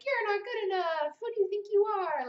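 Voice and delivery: high, mocking inner critic. Writer voicing her inner critic's mocking doubt. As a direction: sneer it out. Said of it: "identify all the voices".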